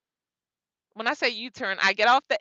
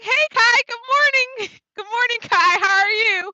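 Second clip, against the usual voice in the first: much higher, louder mock-cheerful morning call; cheery mentor greeting; 11 semitones higher, 4 dB louder than usual. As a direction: play the mentor chirping good morning. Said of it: "he like".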